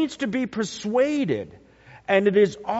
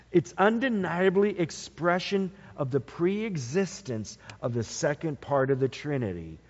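normal talking voice

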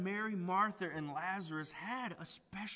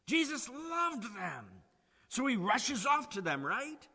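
emphatic preaching; 5 semitones higher, 7 dB louder than usual. Acted.

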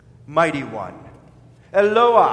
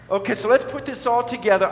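ordinary talk, nothing marked

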